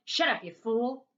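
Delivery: loud, angry owner's yell; sharp shouted scolding. A narrator's reading. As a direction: yell it like a mean dog owner.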